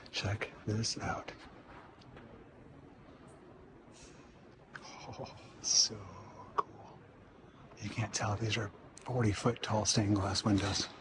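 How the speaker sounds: hushed library whisper, slow. Real, not acted. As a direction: whisper so you don't disturb readers. Hushed inside the silent reading room.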